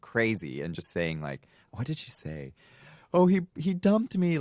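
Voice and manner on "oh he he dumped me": high, loud hysterical wail; mock crying scream. Friend faking a breakup over the phone, mock crying and screaming. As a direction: retell a friend's hammed-up crying fit. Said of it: "just crying and screaming".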